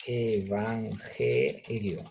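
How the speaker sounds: EVANGELIO very slowly, split e-van-ge-lio; syllable by syllable. Shown as a demonstration. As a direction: stretch out each syllable of EVANGELIO with small breaks. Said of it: "es una emisión de voz".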